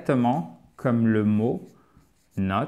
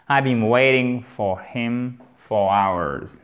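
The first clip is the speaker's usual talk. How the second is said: slow model sentence; 3 semitones lower, slower than usual. Shown as a demonstration. Teacher models the full sentence.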